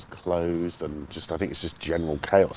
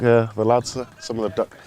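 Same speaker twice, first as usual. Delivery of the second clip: ordinary delivery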